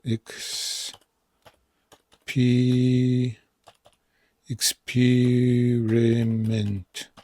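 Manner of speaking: experiment drawn out, syllable by syllable; very slow demo. Shown as a demonstration. Teacher slowly modelling the word for the class. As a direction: experiment slowed right down, ik-spi-ri-ment. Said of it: "Double P".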